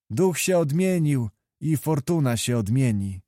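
ordinary delivery

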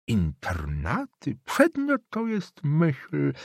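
put-on slow, drawn-out old man's approval; old councillor praising the boarding schools, slow and smug; sly old councillor. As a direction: savour each word like a smug old official. Old councillor Somonowicz speaks.

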